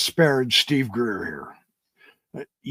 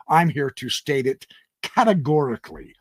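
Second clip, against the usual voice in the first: usual speaking voice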